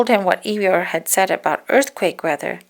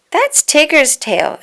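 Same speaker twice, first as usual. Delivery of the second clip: higher, louder, slow exclaim; 8 semitones higher, 7 dB louder, slower than usual. Acted. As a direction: point it out with delight. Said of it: "exclaimed Pooh".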